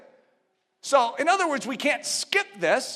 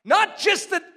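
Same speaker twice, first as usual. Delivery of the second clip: higher and louder, slowing to drive it home; rising preaching; 5 semitones higher, 4 dB louder than usual. Acted. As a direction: preach it with conviction. Preaching at full force.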